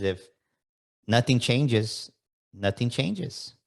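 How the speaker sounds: slow, each "nothing changes" weighted; host slowly stressing "nothing changes"; slow emphatic maxim. Shown as a demonstration. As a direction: drop the words slowly so they land. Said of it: "Another way I can tell you is".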